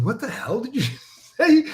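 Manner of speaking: amused, incredulous mock demand; laughing disbelief. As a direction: crack up while quoting your baffled reaction. Retold reaction, breaking into laughter.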